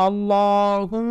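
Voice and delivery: "আল্লাহুম্মা" chanted with a long, full "ল" and held hum; long thick vowel. Shown as a demonstration. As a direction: draw out the middle of "আল্লাহুম্মা" slowly. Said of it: "লম্বা করে পড়তে হবে".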